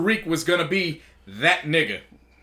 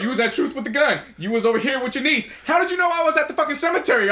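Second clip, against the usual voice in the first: heated mock quote; 7 semitones higher, faster than usual. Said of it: "he said".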